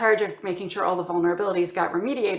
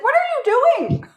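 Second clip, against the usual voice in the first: squeaky, much higher, louder mock of the exasperated boss; cartoonish boss losing it, pitched way up; 7 dB louder than usual. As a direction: ham up the boss's disbelief in a shrill squeal. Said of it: "And he's like".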